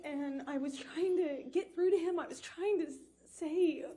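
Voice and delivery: high voice, fighting through tears; tearful distress. Real, not acted. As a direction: plead through tears, dragging out the last words. Tearful account of pleading with him.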